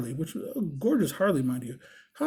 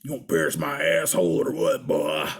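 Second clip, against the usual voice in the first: very deep biker voice; mock gruff biker, far below your own pitch; imitated big biker; 12 semitones lower than usual. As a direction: drop way down into a burly biker's voice. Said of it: "Looks at you and says".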